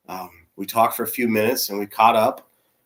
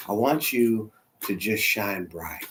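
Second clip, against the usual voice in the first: slow, softer and lower; tender encouragement; 3 semitones lower, 7 dB quieter, slower than usual. Retold or imitated. Mama Kelly holding his hand, slow and tender. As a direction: urge him gently, taking your time.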